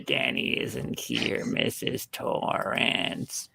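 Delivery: very low, slow, whispery Shining croak; creepy Danny voice. Pretend. Answering a Danny Torrance call in the eerie Shining voice. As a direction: croak it like the possessed boy. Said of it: "Hey, Danny Torrance".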